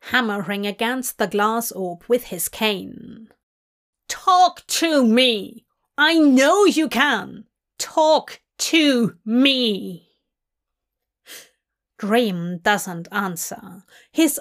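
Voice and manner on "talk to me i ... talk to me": loud, drawn-out demanding shout; desperate demand. A narrator's reading. Burgess yelling at the silent captive in the orb, loud demand. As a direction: shout it slowly, each word hammered out.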